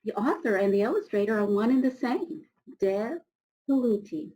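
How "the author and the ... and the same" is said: ordinary delivery, nothing marked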